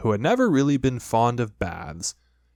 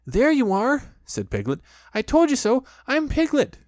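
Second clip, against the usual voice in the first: Piglet's triumphant squeak; 14 semitones higher, 4 dB louder than usual. A narrator's reading.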